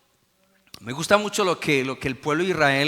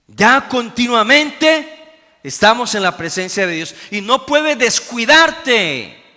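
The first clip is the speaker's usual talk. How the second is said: high, loud preaching climax; preaching shout; 7 semitones higher, 11 dB louder than usual. Real, not acted. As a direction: ramp it up and shout continuamente. Predicación en pleno clímax. (predicación a gritos, muy alta y fuerte)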